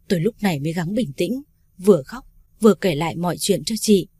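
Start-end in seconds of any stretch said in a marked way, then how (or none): none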